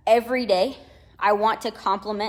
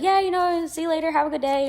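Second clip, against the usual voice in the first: cheerful goodbye; 8 semitones higher, 3 dB louder than usual. Retold or imitated. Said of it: "He was just like".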